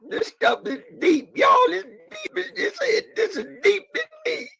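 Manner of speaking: words pushed out through real tears; crying. Real, not acted. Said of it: "Sorry for the crying".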